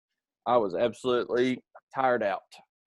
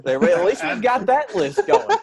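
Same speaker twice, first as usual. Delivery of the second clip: laughing; 7 semitones higher than usual. Real, not acted. Joking about never remarrying.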